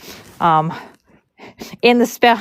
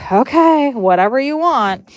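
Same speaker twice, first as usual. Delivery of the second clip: retold dubious concession; 6 semitones higher than usual. Barn manager giving in to the braider, high dubious lilt. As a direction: give in with a skeptical lilt.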